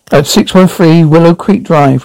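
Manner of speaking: ordinary delivery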